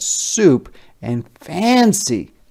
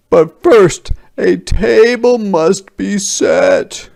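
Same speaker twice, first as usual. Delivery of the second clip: higher, louder, slow grand soldier; soldiers announcing; 9 semitones higher, 9 dB louder than usual. A narrator's reading. Soldier inviting the whole village, higher, louder and slow. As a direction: proclaim it slowly to the crowd. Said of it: "the soldiers said".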